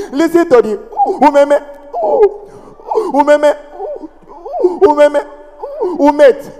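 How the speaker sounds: blocks between every few words; mock stammer. Stammering driver scolding the noisy passengers, hammed-up stammer. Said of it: "Driver started".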